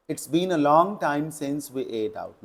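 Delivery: very slow, word by word reading; slow model sentence. Shown as a demonstration. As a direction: dictate the correct sentence slowly. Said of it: "ये सही concept है". (बहुत धीमे, शब्द-दर-शब्द)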